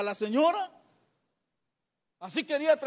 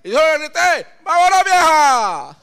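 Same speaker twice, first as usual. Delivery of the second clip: boastful shout; 3 semitones higher, 11 dB louder than usual. Retold or imitated. Father telling his son how he carried off mom, yelling. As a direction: build the brag up to a big shout.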